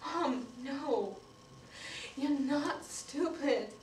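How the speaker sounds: soft, slow correction to mom; gentle reassurance. Acted. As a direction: tenderly let her down, slowing right down. Child gently correcting, reassuring mother.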